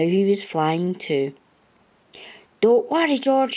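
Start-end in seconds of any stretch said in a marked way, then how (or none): 2.6-3.6 raised, higher call across the air. A narrator's reading. Man calling up to George in the balloon, loud and reassuring. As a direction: call out reassuringly to a friend far off. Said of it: "he called".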